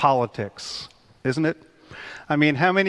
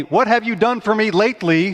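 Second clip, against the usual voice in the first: higher, louder demanding fan; 7 semitones higher, 4 dB louder than usual. Retold or imitated. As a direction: needle the coach like a fed-up fan. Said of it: "I call it the".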